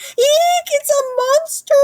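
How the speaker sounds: very high, loud squawk of fright; frightened duck. A narrator's reading. Duck terrified by pumpkin-covered Dragon, high shriek. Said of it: "cried the duck".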